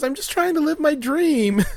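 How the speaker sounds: lightly imitated drunk girl, pitched high; high mock whine of a thwarted party girl. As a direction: playfully voice a drunk girl pleading. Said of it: "a drunk girl wakes up".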